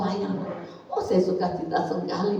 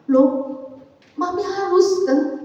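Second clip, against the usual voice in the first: put-on surprised child; 9 semitones higher, slower than usual. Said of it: "Saya bilang".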